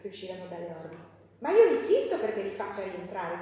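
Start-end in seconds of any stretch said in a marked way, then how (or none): 1.4-3.4 much louder insisting demand; a furious father shouting at the governess. Acted. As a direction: bark it like an angry employer. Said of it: "gridò lui, avvicinandosi furioso".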